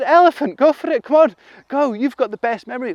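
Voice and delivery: high, loud eager urging; high excited pleading. Acted. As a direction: egg the elephant on. Animals urging the elephant.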